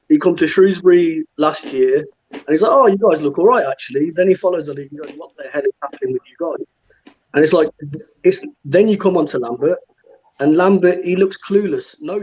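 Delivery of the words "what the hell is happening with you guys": normal talking voice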